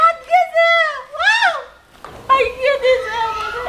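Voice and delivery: high, stretched howl of pain; pained wailing. Acted. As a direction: howl as if badly hurt. Wails that arm was broken. (ব্যথায় চিৎকার করে কান্না)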